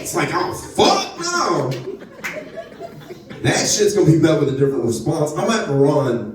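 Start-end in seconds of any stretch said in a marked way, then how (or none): 0.3-1.7 high, drawn-out indignant refusal; outraged refusal. Retold or imitated. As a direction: stretch it out in high disbelief. Imagined reaction to his rapping.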